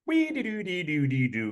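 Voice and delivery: sung-out phone ring starting high and falling; spoken cartoon ringtone; imitated phone ringtone. Author imitating uncle's phone, tootling ringtone. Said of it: "goes his phone".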